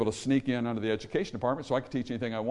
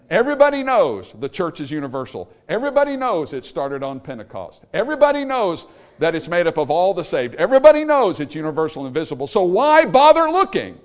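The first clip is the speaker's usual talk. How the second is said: loud, emphatic pulpit refrain; rising preacher's fervor; forceful preaching; 3 semitones higher, 7 dB louder than usual. Real, not acted. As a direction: hammer each repeat, building to a high, loud cry.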